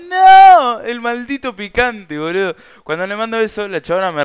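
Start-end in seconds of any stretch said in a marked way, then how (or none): 0.1-2.6 loud, much higher, cartoonish boast; mock hype voice. Bragging to the camera about his own pickup line, in a loud high cartoon voice. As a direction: crow about yourself like a cartoon show-off. Mock hyping himself after message.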